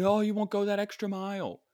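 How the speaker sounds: high-pitched mock disapproval; mocking complaint. Imagined boss faulting him for clocking off, mocking.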